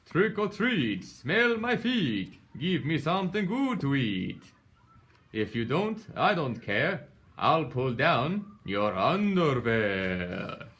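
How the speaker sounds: Dracula voice. Acted. Little frog playing vampire chants at the door, hammed-up Dracula voice. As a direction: ham up a kid's Dracula rhyme, stretching it out. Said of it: "said in his best Dracula voice".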